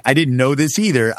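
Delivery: normal talking voice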